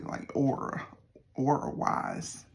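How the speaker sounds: "aura" in a Southern country drawl. Real, not acted. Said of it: "if I'm saying the word a little weird".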